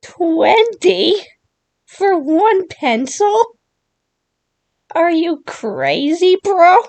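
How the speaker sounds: high, disbelieving questions; can't believe the price, voice jumping up; reacting in disbelief to a pencil costing twenty; incredulous. Real, not acted.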